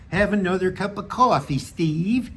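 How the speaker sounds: badly faked Minnesota accent; terrible Minnesota accent. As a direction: do a hammy, not very good Minnesota accent. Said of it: "terrible Minnesota accent, sorry".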